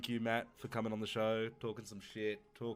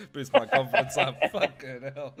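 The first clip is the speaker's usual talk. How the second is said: louder, laughing through the words; 8 dB louder, slower than usual. Real, not acted. Host laughing at the guest's green whistle story. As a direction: crack up mid-sentence.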